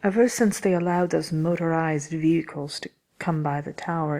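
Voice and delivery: ordinary talk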